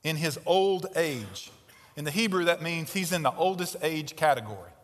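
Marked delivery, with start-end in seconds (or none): 0.0-1.5 put-on "In his old age" slowed right down; slow emphasis. Shown as a demonstration. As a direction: let the age sink in. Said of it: "make sure that you get this".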